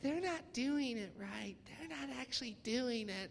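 mock a little snitch telling on others; tattletale. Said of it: "these little tattletales are like".

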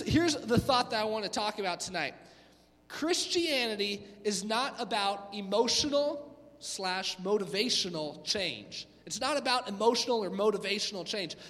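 ordinary talk, nothing marked

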